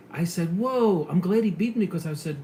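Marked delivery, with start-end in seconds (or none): 0.6-1.1 startled whoa. Retold or imitated. Startled by horn.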